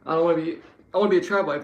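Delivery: lightly mock the would-be tradwife. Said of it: "not tricath, trad wife".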